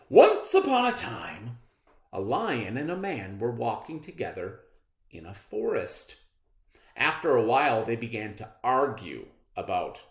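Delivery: put-on storytelling voice for a class of kids; story narration. A narrator's reading. Teacher reading a fable to her class, storytelling voice. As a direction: read it like a fable to children.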